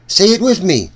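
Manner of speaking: ordinary delivery, nothing marked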